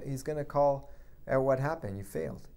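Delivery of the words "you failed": a bare whisper, no voice. Acted. Preacher whispering Jesus' words to Peter, hushed. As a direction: breathe the two words out.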